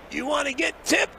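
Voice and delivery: high-pitched squeezed voice, breath held in; strained high squeak. Retold or imitated. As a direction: squeak it out while holding in smoke. Acts Hopper urging caddy to smoke.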